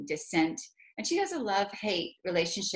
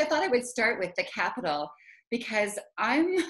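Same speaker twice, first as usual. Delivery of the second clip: usual speaking voice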